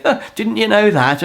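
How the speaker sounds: high, sneering mock question; put-on scoff, pitched well up; mocking needling. Games player needling an engineer all evening, high and mocking.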